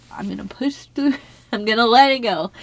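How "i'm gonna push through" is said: usual speaking voice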